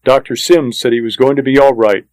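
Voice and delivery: plain reading voice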